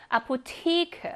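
아포테이크 slowly, stressed on the long 테이; slow teaching demo of 아포테이크, 테이 stressed; marked word stress. Shown as a demonstration. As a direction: draw out 테이 in 아포테이크. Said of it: "여기에 강세 있어요".

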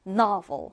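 novel with an open "nah" and a V; NA and V sounds. Shown as a demonstration. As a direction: say "novel" as NAH-vul, with a clear V. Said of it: "나 그리고 V 발음". (novel을 '나'와 V 소리로 또박또박)

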